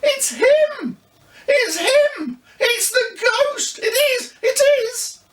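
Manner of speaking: put-on high, excited little girl voice; young girl crying out in delight; delighted child cry. A narrator's reading. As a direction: squeal with delight at finding the ghost. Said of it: "cried delightedly".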